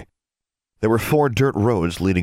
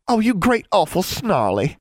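put-on high, girlish indignant retort; exaggerated high-pitched protest; playful retort; 12 semitones higher than usual. A narrator's reading. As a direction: throw it back at a brother. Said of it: "Julia retorted".

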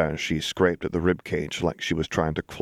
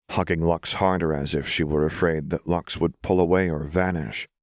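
usual speaking voice

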